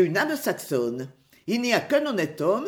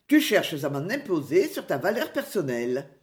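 ordinary delivery, nothing marked

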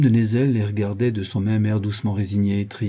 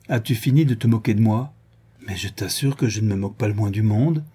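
ordinary delivery

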